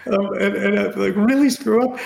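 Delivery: panic. Retold or imitated. Panicked self-mockery retelling.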